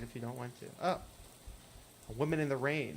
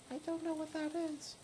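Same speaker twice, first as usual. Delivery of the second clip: put-on much higher, thin ghostly girl voice; hitchhiker Lydia; 14 semitones higher, 4 dB quieter than usual. Hitchhiker asking the player for a ride, high ghostly voice. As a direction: play the strange girl in the rain begging a lift.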